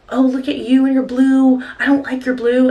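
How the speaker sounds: imitating a yelling stranger. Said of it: "yelling at me about".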